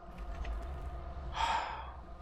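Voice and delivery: long, breathy sigh; heavy sigh. Real, not acted. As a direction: let out a weary sigh. Starving, can't slaughter bull.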